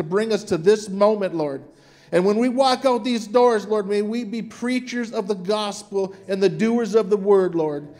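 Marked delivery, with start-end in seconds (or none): none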